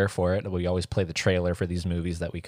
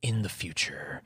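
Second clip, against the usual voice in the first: trailer narrator; 4 dB quieter than usual. Retold or imitated. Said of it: "old-fashioned, narrated trailers where it's like".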